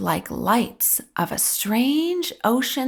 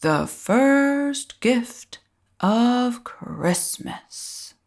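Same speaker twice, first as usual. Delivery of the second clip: Santa calling out; 3 semitones higher, much slower than usual. Acted. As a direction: announce it grandly to the crowd. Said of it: "called out".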